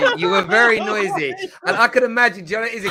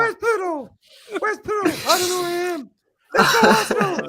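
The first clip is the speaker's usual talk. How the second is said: very high mock squeak; squeaky, lost-little-fan impression; Igal; 10 semitones higher than usual. Teasing the co-host about his match-day voice, high squeaky mimicry. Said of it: "that's honestly how you sound".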